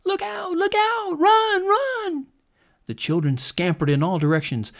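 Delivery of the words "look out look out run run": put-on very high, frantic child's yell; panicked shout. A narrator's reading. Children fleeing the waking dragon, high panicked yells. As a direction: shriek the warning as the ground shakes.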